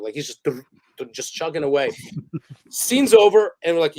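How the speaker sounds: usual speaking voice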